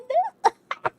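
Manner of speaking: big high cackle of delight; a loud, high whoop of a laugh; loud burst of laughter. Real, not acted. Streamer laughs at nun's insult.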